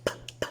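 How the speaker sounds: "P" as soft voiceless pops; P letter sound. Shown as a demonstration. Teacher showing what P says, "P" popped voicelessly. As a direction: puff "P" out with no vowel. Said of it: "The P says P".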